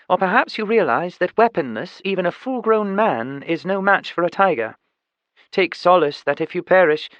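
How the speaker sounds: put-on high, cultivated chimp voice with educated accent; educated chimpanzee lecturer.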